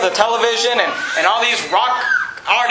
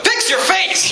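much higher, sharp Fix your face with a push on "Fix"; snapping at someone; 9 semitones higher, slower than usual. Pretend. Pastor wishing to rebuke a moody greeter, sharp high command. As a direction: fire "Fix your face!" straight back at the gloomy greeter. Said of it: "I just wanna walk up to him".

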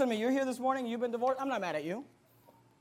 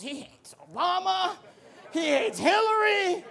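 high-pitched, squealed mimicry; hammed-up high voice of complaint; mock outraged critics; 7 semitones higher, slower than usual.

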